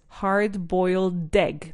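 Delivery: "Hard-boiled egg" slow, with the d running straight into "boiled"; slow teacher model of "Hard-boiled egg"; slow model word. Shown as a demonstration. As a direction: link "Hard-boiled egg" smoothly, one slow breath. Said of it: "Otra vez".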